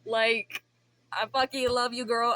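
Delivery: ordinary talk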